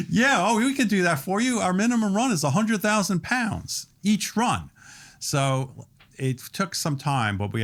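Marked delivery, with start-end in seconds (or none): 0.1-4.7 high, loud mock salesman voice; co-packer on phone. Co-packer answering a restaurant owner's call, high loud mock voice. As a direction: ham up the breezy supplier, pitched way up. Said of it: "Or I go".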